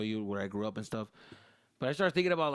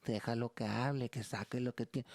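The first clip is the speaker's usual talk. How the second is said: calm mom. Retold or imitated. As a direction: soothe the husband. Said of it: "my mom".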